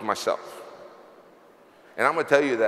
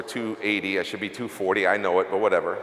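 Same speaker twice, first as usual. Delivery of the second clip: ordinary talk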